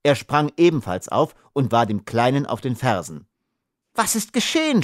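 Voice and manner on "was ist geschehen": much higher distressed call; higher alarmed cry. Acted. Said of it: "schrie die unglückliche Unschida".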